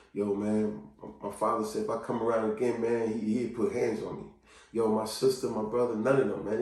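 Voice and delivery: low, soft, dejected admission; subdued acted hurt; downcast confession. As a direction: confide family rejection quietly. Voicing hurt person's hidden confession.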